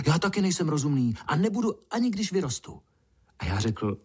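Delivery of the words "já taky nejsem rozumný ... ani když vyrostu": quick, high-pitched ant chatter; blurted eagerly. A narrator's reading. Little ant vows never to be reasonable, blurting. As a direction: rush it out in a high voice. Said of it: "vyhrkl mravenec".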